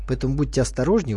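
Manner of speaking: normal talking voice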